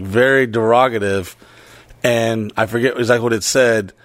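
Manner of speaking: ordinary talk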